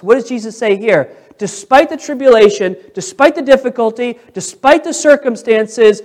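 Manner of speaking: ringing sermon climax with weighty key words; full-force preaching. Real, not acted. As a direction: drive each phrase home to the congregation.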